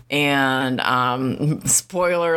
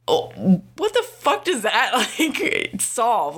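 laughing disbelief; 7 semitones higher, slower than usual. Real, not acted. Exasperated rant about rude reply.

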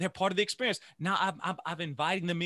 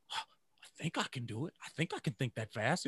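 inner thought; 3 semitones lower, 5 dB quieter than usual. Retold or imitated. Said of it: "that's what the boys are thinking".

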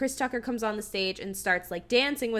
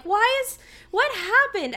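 much higher and louder, utterly baffled; why is this happening, pitched way up; 10 semitones higher, 5 dB louder than usual. Retold or imitated. Recalling his reaction watching the movie, in baffled disbelief.

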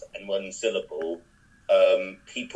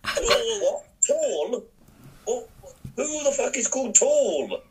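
high, baffled mock outrage; incredulous cafe woman; 13 semitones higher, slower than usual. Cafe woman baffled by the name, high incredulous. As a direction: squeal it out in disbelief. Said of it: "She was like".

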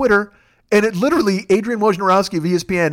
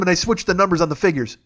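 ordinary talk, nothing marked

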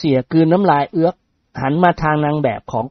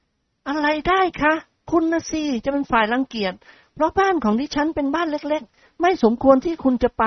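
put-on sweet polite young lady; young woman voice; 11 semitones higher than usual. Model protests modestly. (เสียงหญิงสาวสุภาพอ่อนหวาน)

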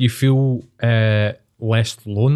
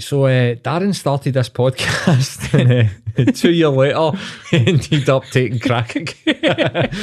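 mock documentary narrator; 3 semitones higher, slower than usual. Teasing his friend about his future, slow laughing mock narration. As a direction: tell it like a grim tale, laughing through. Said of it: "you'll be like".

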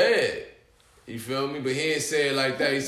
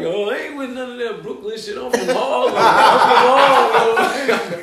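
high protest swelling into a drawn-out cry; mock protest; 7 semitones higher, 8 dB louder than usual. Host disowning Brooklyn trouble, imitated loud protest. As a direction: wail it like a panicked host.

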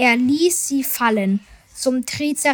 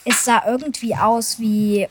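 ordinary delivery, nothing marked

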